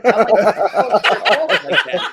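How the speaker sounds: loud, slow, laughing retort. Retold or imitated. Storyteller recalling his reply to Carlos, laughing hard. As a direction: crack up while asking it.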